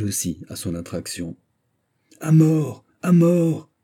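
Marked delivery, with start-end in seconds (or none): 2.2-3.7 high, loud mob chant; crowd shouting. A narrator's reading. Fifty thousand voices cry for the condemned to die, crowd shouting. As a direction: cry it out like a crowd baying for death. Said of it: "crièrent cinquante mille voix".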